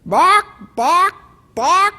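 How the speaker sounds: three high clucked bocks, rising; a hen clucking eagerly for three books; chicken clucking thrice. Acted. Chicken returns a third time, high triple cluck.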